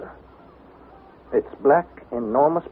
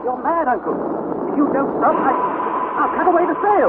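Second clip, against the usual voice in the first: panicked threat; 11 semitones higher than usual. Acted. Nephew panicking, threatening to cut sail.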